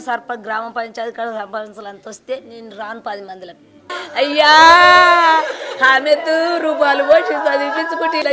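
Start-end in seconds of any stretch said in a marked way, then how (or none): 4.2-8.3 loud, high sung-out wail of grief; keen for her lost daughter, voice rising into a dirge; wailing lament. Real, not acted. Mother mourning her murdered daughter, loud sung wail.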